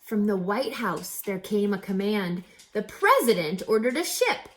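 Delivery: lively rhyming read-aloud with a bouncy lilt; storybook narration. A narrator's reading. Reader telling children a rhyming story, lively. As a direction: read it like a picture book to kids.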